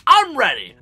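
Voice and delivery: high, loud eager announcement; hyped readiness. Retold or imitated. Vlogger reacting to the stock alert, high hyped outburst. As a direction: pipe up high and loud, raring to go.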